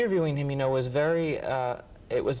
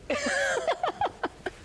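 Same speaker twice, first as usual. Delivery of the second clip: real high-pitched burst of laughter; delighted high laugh. Real, not acted. Amused by his own story.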